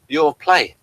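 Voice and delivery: normal talking voice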